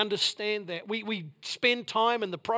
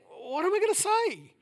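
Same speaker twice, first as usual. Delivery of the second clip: baffled question; 10 semitones higher than usual. Retold or imitated. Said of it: "other than that".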